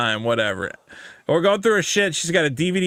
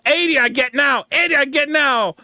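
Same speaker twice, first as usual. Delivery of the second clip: mock Chinese accent; 8 semitones higher than usual. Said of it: "The Chinese guys will just go".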